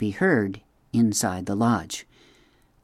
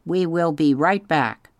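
a higher, even robot voice, careful and kind; polite robot; 6 semitones higher than usual. A narrator's reading. Roz greets the startled beavers, gently robotic. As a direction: speak like a gentle machine trying to reassure.

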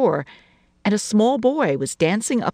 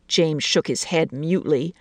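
ordinary delivery

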